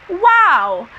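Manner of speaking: high, loud wow of amazement; amazed reaction. Retold or imitated. Reader reacting to the author's acknowledgement, amazed.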